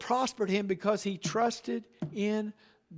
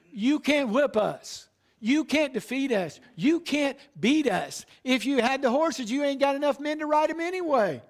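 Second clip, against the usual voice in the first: higher, louder mocking boast of the Assyrian envoy; enemy's taunt; 5 semitones higher, 3 dB louder than usual. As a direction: gloat like an enemy commander. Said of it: "He's saying".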